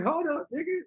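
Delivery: high, startled mock stop; startled halt. Telling the host how he reacted to the OVO mole claim, high startled halt. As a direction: pull up short in alarm. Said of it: "I was like".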